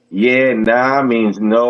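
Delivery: slow explaining. Shown as a demonstration. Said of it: "it's all about how you say".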